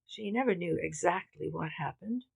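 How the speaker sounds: plain reading voice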